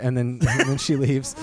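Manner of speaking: ordinary delivery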